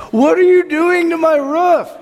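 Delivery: squeaky high, loud outraged yelp; mock-outraged owner shrieking about his roof; indignant homeowner. Preacher mimicking the homeowner, shrill outraged cry. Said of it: "some guy who's like".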